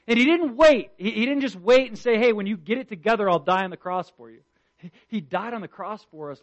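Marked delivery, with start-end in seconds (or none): 0.1-4.4 loud, rising sermon cry, pitched higher; preacher hammering home every sin to the congregation, loud and high; preaching at full force. Acted. Preacher pressing the point to his church, full-force preaching.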